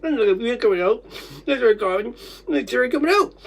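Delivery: high, loud, distraught voice; real upset cry, pitched far up; anguished reliving. Real, not acted. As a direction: wail each phrase separately. Reliving choking in great distress.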